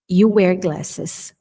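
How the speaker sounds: You wear glasses said slowly, you stressed; slow dictation; slow model. Shown as a demonstration. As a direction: say it slowly for students. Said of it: "teacher repeats".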